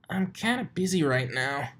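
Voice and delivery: high, strained dragon voice; Spike voice. A narrator's reading. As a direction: voice a little dragon. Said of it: "replied a somewhat smaller pile".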